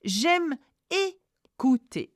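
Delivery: "J'aime écouter" slowly, split into sounds; syllable-by-syllable demo. Shown as a demonstration. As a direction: model "écouter" with a clear closed é. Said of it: "Again, accent aigu".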